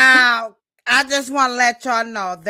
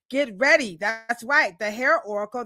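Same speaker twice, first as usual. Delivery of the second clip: ordinary talk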